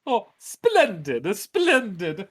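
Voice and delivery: beaming mayor welcoming visitors; jolly mayor. Acted. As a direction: fuss over the guests with glee. Mayor keeps gushing.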